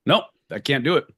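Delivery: usual speaking voice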